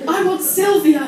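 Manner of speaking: loud, high, overwrought despair; melodramatic teen wail. Retold or imitated. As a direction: wail it like a heartbroken teenager. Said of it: "started crying".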